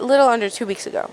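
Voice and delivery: rattled off quickly; fast, hurried repeat. Acted. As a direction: rush the words out. Quick repeat of Asha's words.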